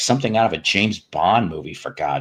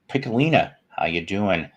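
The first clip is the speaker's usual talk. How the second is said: ordinary talk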